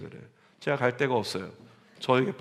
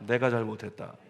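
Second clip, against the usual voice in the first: humble apology; 5 semitones lower, 5 dB quieter than usual. Shown as a demonstration. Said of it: "우리 한번 따라할까요?".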